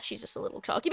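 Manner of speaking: hushed mock admission; conceding aside. Muttered concession in joke defense.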